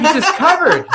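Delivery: high voice dissolving into laughter; laughing through punchline. Retold or imitated. Joke's last line to co-host, laughing high. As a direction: finish the bit while cracking up. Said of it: "he's like".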